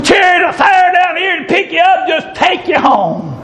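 high, shouted joyful preaching with whoops; preaching at full force. Acted. Preacher celebrating the chariot of fire, shouted preaching with whoops. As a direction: lift the church up with it.

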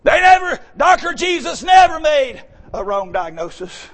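high, loud hammering sermon cry; full-force preaching. Acted. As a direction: preach it out over the congregation, ringing.

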